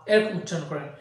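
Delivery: ordinary talk, nothing marked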